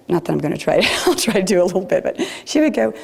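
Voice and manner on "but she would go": ordinary delivery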